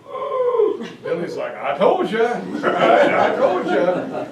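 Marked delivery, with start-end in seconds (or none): 0.1-0.9 gasped high oh of surprise, acted by the lecturer; a crowd's stunned high oh; amazed oh. Officials watching the battleship sink, stunned high oh. 1.6-4.3 laughing through an I-told-you-so; laughing gloat. Retold or imitated. Billy laughing at the brass, gloating.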